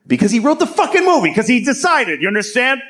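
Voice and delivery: high-pitched comic outrage; worked-up and indignant; exasperated rant. Acted. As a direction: burst out, driving the point home.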